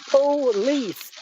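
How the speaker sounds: "Police" said slowly as two clear syllables. Retold or imitated. Asked by cops to say it slowly, careful "Police". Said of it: "Say it again, slowly".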